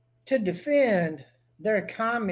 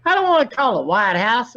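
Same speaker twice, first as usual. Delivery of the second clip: shouting; 5 semitones higher, 9 dB louder than usual. Real, not acted. Angry rant on phone.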